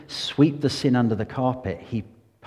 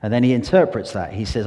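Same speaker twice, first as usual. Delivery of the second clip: usual speaking voice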